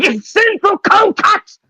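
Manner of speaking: very high, drawn-out mock scream; imitated scream. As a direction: shriek it high and slow in character. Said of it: "Then he screams about".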